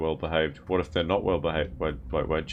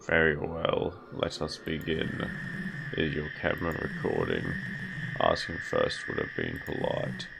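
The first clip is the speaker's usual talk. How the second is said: sinister deep voice; 3 semitones lower, 5 dB quieter than usual. Acted. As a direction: growl it low like a demon. Reads the nun's dialogue aloud.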